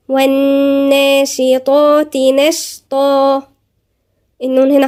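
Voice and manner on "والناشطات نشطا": slow, drawn-out tajweed chant; measured Quranic recitation. Shown as a demonstration. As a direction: recite it melodically with long held vowels. Recites next verse.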